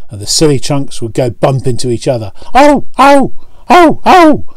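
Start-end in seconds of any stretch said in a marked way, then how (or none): 2.5-4.4 high, loud yelps of pain; crying out trucks. A narrator's reading. Trucks banging together, crying out high and loud. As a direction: squeal each ow sharply. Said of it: "they cried again".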